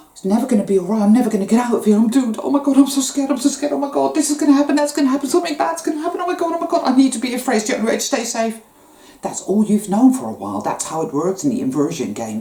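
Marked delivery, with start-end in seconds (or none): 0.1-8.6 imitating a frightened inner voice, much higher and rising; mock panic. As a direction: ham up the dread of the dark side's voice. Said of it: "this tell me".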